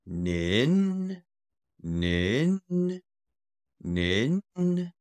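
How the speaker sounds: long NIN rising like a question. Shown as a demonstration. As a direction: draw out NIN and let it climb. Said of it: "a rising tone".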